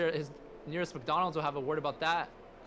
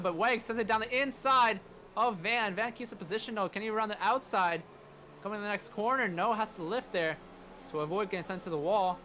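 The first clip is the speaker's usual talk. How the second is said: excited; 5 semitones higher than usual. Real, not acted. Overtake attempt called live.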